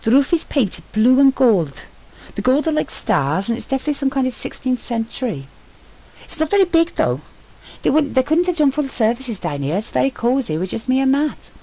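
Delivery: an eager explorer calling up from a hidden chapel; Sentry's excited voice. A narrator's reading.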